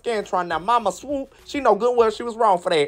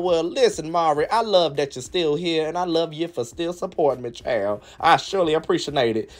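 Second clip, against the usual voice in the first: warm thanks; 9 semitones lower than usual. Real, not acted. Talking to a supporter.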